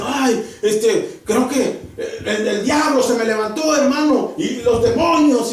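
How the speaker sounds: half-laughing imitation of a believer blaming the devil; mock the excuse with a laugh in the voice; mock complaining believer. Preacher mocking a believer who blames demons, laughing imitation.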